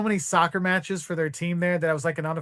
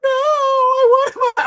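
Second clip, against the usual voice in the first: high, slow, louder whiny cry; mock wail; 8 dB louder, slower than usual. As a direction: wail like a kid losing his toy. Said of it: "I'm like".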